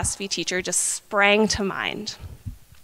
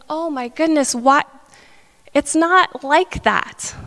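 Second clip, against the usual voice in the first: exasperated student retort, pitched up and drawn out; annoyed; 5 semitones higher, slower than usual. Retold or imitated. Student pushing back at philosophy teacher's question, acted annoyance. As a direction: re-enact being fed up, higher and slower. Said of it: "being so annoyed".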